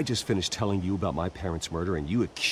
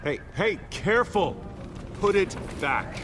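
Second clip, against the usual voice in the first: alarmed protest; 11 semitones higher than usual. Acted. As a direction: snap out a warning to the officers. Police carrying out his belongings.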